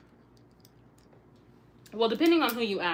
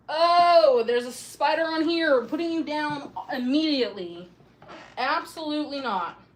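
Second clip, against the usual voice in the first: loud, high spider panic; screaming at a spider; 5 semitones higher, 9 dB louder than usual. Real, not acted. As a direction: shout it, recoiling from the spider. Finds a spider on it.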